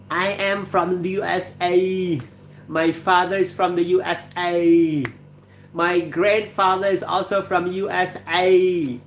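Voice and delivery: slow, high attempt at an Arabic accent; overdone Arabic movie villain accent. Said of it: "I cannot, uh, imitate the, the Arabic accents".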